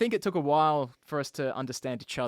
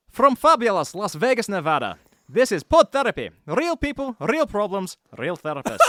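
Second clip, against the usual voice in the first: loud, high Scottish brogue; hammed-up Scottish announcer with rolled Rs; Scottish accent; 6 semitones higher, 5 dB louder than usual. Guest reading the show intro as a challenge, hammed-up Scottish accent. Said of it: "Read that paragraph in your Scottish accent".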